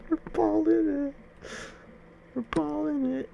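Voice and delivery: very high, cartoonish hype; squeaky voice. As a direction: squeak it out in triumph. Squeaky hype voice while playing.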